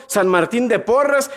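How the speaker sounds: normal talking voice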